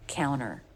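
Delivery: "Counter" said as "counner"; dropped T. Shown as a demonstration. Teacher repeating counter for learners with the T dropped. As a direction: leave the T out of "Counter". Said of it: "just dropping the T completely".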